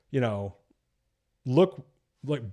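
normal talking voice

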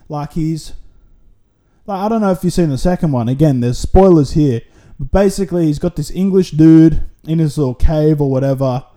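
normal talking voice

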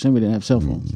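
ordinary delivery

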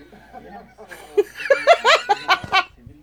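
loud high laugh; big laugh. Real, not acted. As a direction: burst out laughing. Laughs at own joke.

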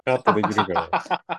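quick, high laughing repeat; laughing echo. Real, not acted. Laughing echo of cohost's line.

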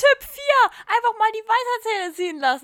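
high, loud ironic announcer tone; mock advice. Host giving a joke wisdom-teeth tip to listeners, mock cheerful announcer. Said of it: "der letzte Tipp war nicht ernst gemeint".

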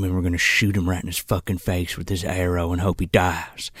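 low, hushed character voice; McConaughey. Retold or imitated. Co-host retelling McConaughey's battle plan, low hushed impression. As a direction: play it low and quiet, under your breath. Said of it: "So McConaughey's like".

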